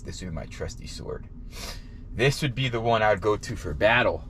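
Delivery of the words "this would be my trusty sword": dramatic hushed whisper. Pretend. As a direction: breathe it like a secret. Playful knight fantasy about shoe.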